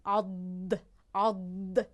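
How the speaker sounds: عد low and isolated; letter demo. Shown as a demonstration. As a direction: sound out the letter. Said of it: "چسبانیدن کامی به کام بالا پیش زبان". (نمایش تلفظ حرف ضاد)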